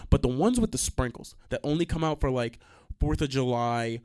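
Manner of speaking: usual speaking voice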